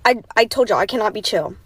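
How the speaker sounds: usual speaking voice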